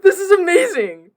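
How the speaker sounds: high, loud, laughing cry of joy; laughing with pure joy; ecstatic laughing. Real, not acted.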